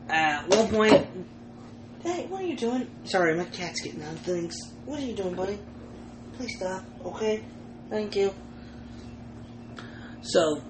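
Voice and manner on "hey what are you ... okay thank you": talking to the cat. Real, not acted. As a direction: softly tell the cat off.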